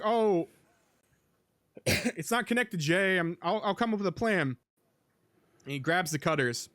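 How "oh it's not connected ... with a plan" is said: higher, lightly acted urgent call; hurried, raised voice, half-acted; urgent character. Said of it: "so he's like".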